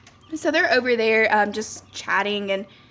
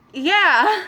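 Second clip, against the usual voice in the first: higher, louder drawn-out yeah; awkward agreement; 7 semitones higher, 6 dB louder than usual. Retold or imitated. Clerk humoring a baffling customer, high awkward agreement. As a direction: play along in a raised, put-on voice.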